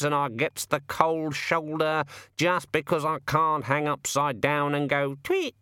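a put-upon bird having a moan, slowly; raven voice. Acted. The raven grumbles about bird tables.

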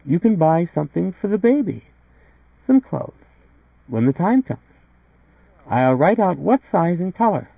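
put-on high-pitched young wife character; wife's voice. A narrator's reading. Wife telling visitor what to buy for the baby, high-pitched.

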